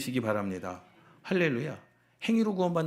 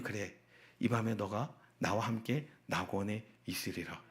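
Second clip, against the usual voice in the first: lightly voiced Jesus, low and soft; solemn Lord's promise; 6 semitones lower, 10 dB quieter than usual. Jesus promising paradise to the robber, low and solemn. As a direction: grant it gently in a lowered voice. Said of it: "그랬더니요".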